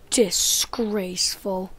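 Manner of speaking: slow unvoiced whisper. Chicken scolds the shooter, slow disgusted whisper. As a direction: breathe out mock disgust. Mock outrage after being shot.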